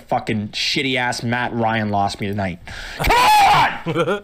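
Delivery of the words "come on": high, drawn-out gambler's yell; urgent shout. Real, not acted. Gambler urging the bet to win, urgent shout. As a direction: scream it at the table.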